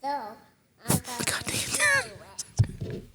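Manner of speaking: frustrated squeak. Real, not acted. Frustrated outburst.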